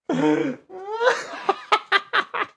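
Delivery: high real giggle; cracking up at his own bit. Real, not acted. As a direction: laugh at your own joke. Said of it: "I'm making myself laugh".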